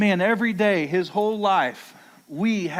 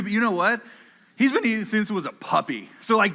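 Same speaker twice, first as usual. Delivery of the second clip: usual speaking voice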